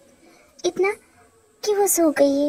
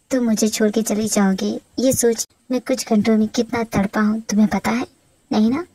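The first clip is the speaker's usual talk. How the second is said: lower-pitched husband voice, mildly wounded; hurt husband; 5 semitones lower than usual. Acted. Husband telling wife how he suffered, lightly hurt. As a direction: reproach her in a low husband voice.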